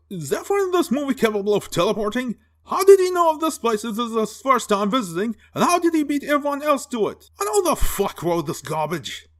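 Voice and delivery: high-pitched rapid-fire questions; indignant, incredulous rant; exasperated disbelief. Acted. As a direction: rattle off baffled questions, voice shooting up. Baffled rant at a plot hole.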